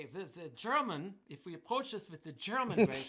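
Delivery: loud mock German accent. Drummer quoting his old teacher to students, loud German accent. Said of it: "he used to say".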